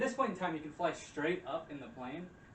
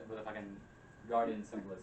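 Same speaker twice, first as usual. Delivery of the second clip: normal talking voice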